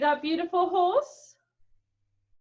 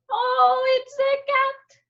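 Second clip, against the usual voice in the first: oh, a cat, much higher; higher delighted surprise; 10 semitones higher, slower than usual. Acted. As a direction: squeak up with wonder. Discovering cat.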